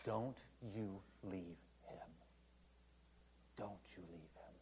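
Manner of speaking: a near-whisper of divine urging; hushed plea. Acted. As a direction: press it home gently. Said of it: "God is crying out to us".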